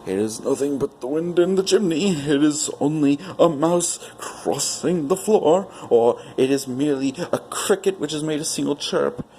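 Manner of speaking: put-on old man nervously reassuring himself; old man comforting himself. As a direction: talk yourself out of fear. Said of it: "saying to himself".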